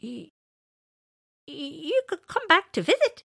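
high, hesitant creature voice; kindly elephant. Acted. Elephant invites Winters back, kindly and high. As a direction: stammer a kind invitation in a high voice. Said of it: "suggested Elephant kindly".

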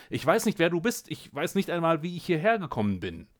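high, loud, rushing panic; panicked. Acted. The lost scientist pleads with the cat, high and panicked. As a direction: voice climbing in desperate confusion.

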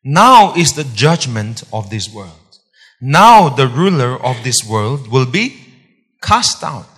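loud, ringing pulpit reading; full-force preaching. Acted. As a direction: proclaim it to the whole congregation.

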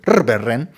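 日本人 said as ルルベンレン, with rolled, foreign-sounding sounds; foreign-sounding pronunciation. Shown as a demonstration. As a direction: say ルルベンレン the way an elderly Uyghur speaker says 日本人. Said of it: "もろ外国人みたいですよね". (外国人っぽくルルベンレンと発音)

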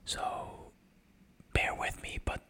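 hushed whisper so neighbors sleep; breathy late-night whisper. Shown as a demonstration. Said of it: "this episode to be fully whispering".

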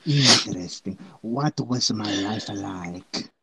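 mock Eastern European accent. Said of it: "you sound like you are from somewhere in Eastern Europe".

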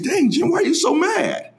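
high mock friend voice; imitating friend. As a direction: play his teasing question. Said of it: "He said".

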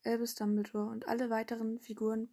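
usual speaking voice

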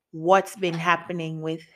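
ordinary delivery

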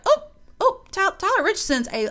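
high, surprised oohs; high-pitched playful astonishment; mock double take. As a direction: stammer it out high and loud, impressed by your own reflection. Acted double take at mirror.

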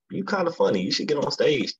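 high-pitched quoted encouragement; imitating a woman. Voices woman urging him on.

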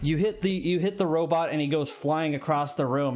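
ordinary delivery

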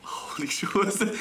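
breathless whispered laughing shock; surprised whisper. Real, not acted. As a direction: gasp it out under your breath. Startled by the funny sound.

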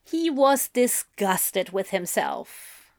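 usual speaking voice

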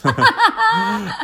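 high burst of laughter. Real, not acted. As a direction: laugh out loud. Laughs after reading update.

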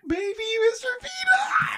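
high-pitched delighted shriek; excited squeal. Retold or imitated. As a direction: squeal it like a thrilled fan. Imitating fans excited by mascot.

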